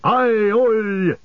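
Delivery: high, drawn-out rallying call; dwarf's shout. A narrator's reading. Gimli shouting to the defenders, high battle shout. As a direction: call out over the din of battle. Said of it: "he shouted".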